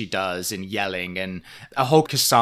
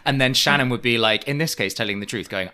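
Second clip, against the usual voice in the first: normal talking voice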